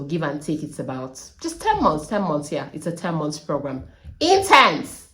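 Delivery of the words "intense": Intense punched high and loud; one emphatic, high burst on Intense; stress on Intense. Real, not acted. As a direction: hit "Intense" hard, much louder and higher. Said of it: "I'll stress on that word".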